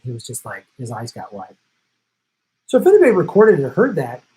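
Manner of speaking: ordinary talk, nothing marked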